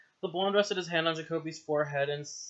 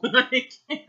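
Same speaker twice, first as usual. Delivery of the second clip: usual speaking voice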